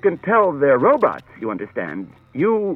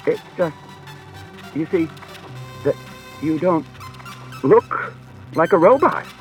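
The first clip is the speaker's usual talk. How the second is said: desperately; 7 semitones higher than usual. A narrator's reading. Bailey confessing to the robot, desperate and higher. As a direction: blurt it out, flustered and pleading. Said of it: "said Bailey desperately".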